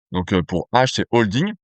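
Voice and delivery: usual speaking voice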